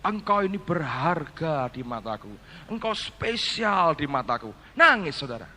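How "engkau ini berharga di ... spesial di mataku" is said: soft, slow, gentle words of God; a hint of a tender, slowed voice of God; tender divine voice. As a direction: speak it like a loving whisper from heaven. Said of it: "Roh Kudus berkata kepada saya".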